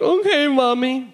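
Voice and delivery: put-on high, slow babyish voice; mock-tearful obedient kid, drawn out; whimpering little child. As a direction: answer slowly in a high whine. Tearful son answering mom.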